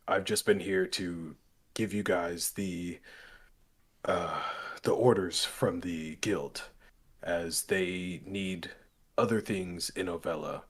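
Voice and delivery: low, slow, fumbling formal apology; cowed guild messenger. Acted. Guild man apologises to His Holiness and explains orders, halting and cowed. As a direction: grope haltingly for respectful words.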